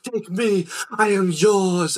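high, loud mock swooning offer; hammed-up theatrical surrender, drawn out; eager audience woman. Woman standing up in the audience, mock-dramatic self-offering. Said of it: "one of the women, like, stands up".

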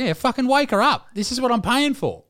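高八度地大声嚷嚷; 半开玩笑地嚷着把医生叫醒; mock demanding yell. Retold demand to wake obstetrician.

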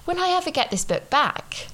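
high, fretful mock worry; hammed-up owner's worry; worried inner voice. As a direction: fret that the book is gone for good.

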